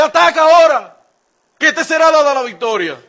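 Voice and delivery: loud, high proclamation; bold prophecy. Retold or imitated. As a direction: call it out to the king. Micaías answers the king. (proclamación en voz alta)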